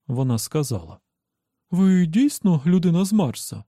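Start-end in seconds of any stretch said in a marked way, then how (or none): 1.7-3.6 higher and louder, amazed; astonished question. Acted. Dawn meets the man from Mars, amazed question. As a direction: ask it with wide-open wonder.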